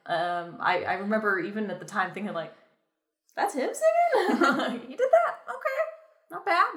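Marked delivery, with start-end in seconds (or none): 3.3-6.7 high-pitched, amused disbelief; squeaky-high astonishment with a laugh in it; surprised reaction. Retold or imitated. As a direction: relive being pleasantly surprised, half laughing. Acts out her surprised reaction back then.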